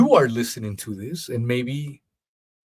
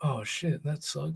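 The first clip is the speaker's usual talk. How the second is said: much softer, disappointed mutter; deflated reply; 5 semitones higher, 9 dB quieter than usual. Retold or imitated. Host replaying his reply to the excuse, soft deflated mutter. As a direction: let the air out, barely audible.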